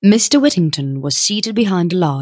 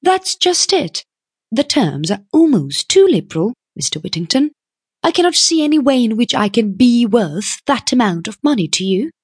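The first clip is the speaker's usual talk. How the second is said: high-pitched, sceptical heroine; plucky young woman; 7 semitones higher than usual. A narrator's reading. Young woman doubts the too-liberal terms, sceptical. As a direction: challenge him in a high voice.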